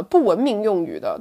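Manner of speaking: usual speaking voice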